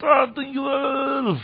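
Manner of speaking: high, long-held pleading call; crying out. Retold or imitated. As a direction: imitate a cry for help pitched high, without shouting. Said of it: "schreit er".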